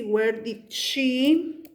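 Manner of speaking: each word drawn out very slowly, What time did she; slow dictation. Shown as a demonstration. Teacher dictating to students while writing, very slow. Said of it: "pude haber puesto así".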